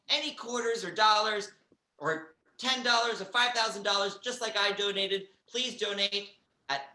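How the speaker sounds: upbeat donation plug to the room; fundraiser announcement. Acted. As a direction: project the appeal like a telethon host. Host's fundraising appeal.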